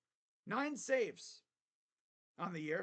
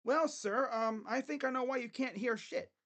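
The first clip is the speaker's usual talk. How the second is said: doctor imitation; 7 semitones higher, 3 dB louder than usual. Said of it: "the ear doctor's like".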